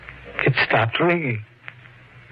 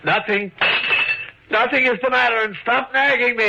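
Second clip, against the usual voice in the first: higher, tense, defensive snapping; irritated snap; 8 semitones higher than usual. Acted. Husband snapping at his wife to stop nagging, tense and irritated. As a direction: cut her off sharply, rattled.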